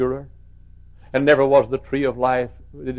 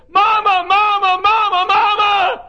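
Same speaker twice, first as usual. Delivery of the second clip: high, loud cries of Mama; daughter calling out; 10 dB louder, slower than usual. Retold or imitated. Daughter running to her mother, excited calling. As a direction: call out running down the hallway.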